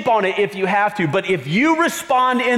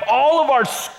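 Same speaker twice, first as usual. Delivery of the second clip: ordinary delivery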